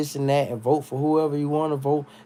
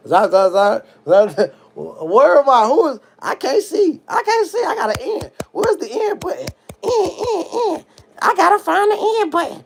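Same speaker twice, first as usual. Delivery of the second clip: hammed-up drunk; 5 dB louder than usual. Pretends drunk blindness, can't find end.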